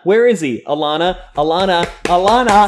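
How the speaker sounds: dramatised urgent summons; mock insistent calling. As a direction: call the name over and over, building. Acted calling for Alana, insistent.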